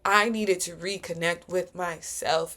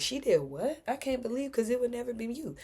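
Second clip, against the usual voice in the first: higher, mock-scandalized gossip; imitating shocked critics; 5 semitones higher, 5 dB quieter than usual. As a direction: play the disbelieving onlooker. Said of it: "I love when people say that".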